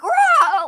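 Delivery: loud, high mock roar of rage; mock angry roar. Retelling the lady's outburst, a loud mock roar. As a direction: roar at her like a raging beast. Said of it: "she's like".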